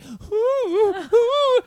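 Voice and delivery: high yelping dog cries; dog whimpering. Retold or imitated. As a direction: cry out like a frightened little dog. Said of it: "the dog would, like".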